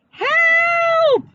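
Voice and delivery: put-on a trapped boy shouts for help. A narrator's reading. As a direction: scream for help as a small boy. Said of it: "shouted Eddie".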